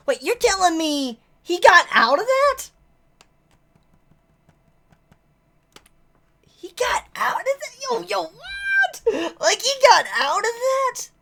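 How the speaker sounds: can't believe what you're reading; stunned disbelief. Real, not acted. Shocked character survived.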